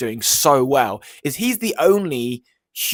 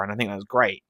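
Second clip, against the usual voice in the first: ordinary delivery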